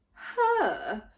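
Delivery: Her? punched on the H, scornful; stress on the H. A narrator's reading. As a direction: blast the H of Her in disgust. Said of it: "placing strong emphasis on the H".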